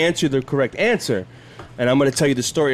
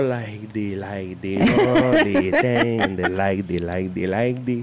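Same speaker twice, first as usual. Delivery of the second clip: slow, sing-song mock Cuban accent; imitating her Cuban accent; 7 semitones lower, slower than usual. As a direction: stretch out a teasing Cuban accent. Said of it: "she has an accent".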